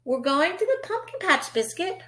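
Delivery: bright, lilting picture-book read; reading to children. Acted. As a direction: read it like storytime for a toddler.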